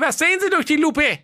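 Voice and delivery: very high, squeaky king voice; comic king voice. Acted. (hohe quäkige Königsstimme)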